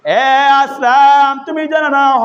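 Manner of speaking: high, drawn-out chanted hail; calling out. Acted. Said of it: "ডাক দিয়া বলেন". (টানা সুরে জোরে ডাক)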